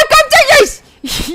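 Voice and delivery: high, loud laughing shriek; startled scream. Real, not acted. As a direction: shriek in fright, half laughing. Startled mid-fight. (놀라 웃으며 비명)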